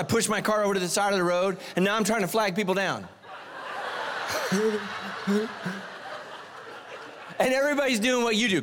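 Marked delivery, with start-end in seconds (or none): 3.3-7.3 long wheezing silent laugh; breathy laugh. Real, not acted. Preacher laughing at himself before the congregation, breathy laugh. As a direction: laugh without voice, breath only.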